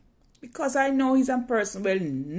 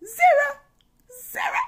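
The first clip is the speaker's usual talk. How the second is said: anguished; 6 dB louder than usual. Real, not acted. Reliving the missed numbers.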